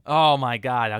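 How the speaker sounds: loud disbelieving exclamation; can't believe he hasn't seen it; loud outraged surprise; shocked. Real, not acted. Shocked friend hasn't watched.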